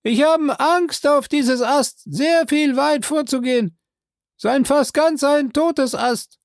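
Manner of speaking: inch out the words warily; frightened servant calling down from the branch; Jupiter, a bit scared. Acted.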